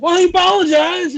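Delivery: mock dad. Dad shrugging off the cursing, high put-on voice. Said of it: "He's like".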